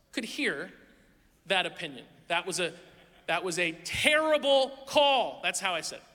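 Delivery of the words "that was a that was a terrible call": terrible punched, loud and slow; louder mock call-out. Shown as a demonstration. Pastor showing the church how loudly he said it. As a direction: yell it across the gym at the ref. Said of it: "It was louder".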